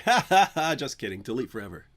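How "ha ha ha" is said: mock laugh. Fake laugh after cry joke.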